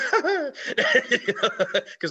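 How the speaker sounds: a high cackling laugh; helpless high laugh; high burst of laughter. Real, not acted. Laughing at comic bombing.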